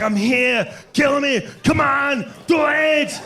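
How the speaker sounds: put-on high, shouted Austrian-accent challenge; Arnold in Predator. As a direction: dare the alien like Arnold in the mud. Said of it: "He was like".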